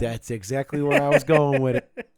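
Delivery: big gleeful shout of agreement; delighted agreement. Real, not acted. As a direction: burst out, thrilled they had the same joke. Delighted friend guessed the same joke.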